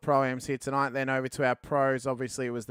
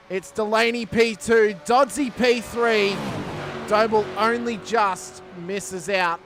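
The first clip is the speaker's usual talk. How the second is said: excited race call, raised and building to the line; live race play-by-play; 4 semitones higher, 3 dB louder than usual. Real, not acted. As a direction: call the finish with rising energy.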